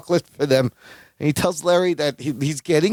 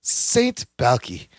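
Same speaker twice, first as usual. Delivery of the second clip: Balki; 5 semitones higher, much slower than usual. Retold or imitated. Imitating the cousin admiring his new title, smug delight. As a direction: savour the title, then pipe up pleased. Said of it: "Balki just looks up and goes".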